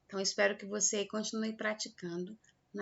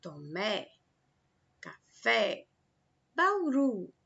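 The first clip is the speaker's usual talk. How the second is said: Tomé, café, Bauru, each stressed on its last syllable; teacher's word list, endings of Tomé, café, Bauru pushed; stress on final syllables; much slower than usual. Shown as a demonstration. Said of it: "a última sílaba aí é pronunciada com mais força".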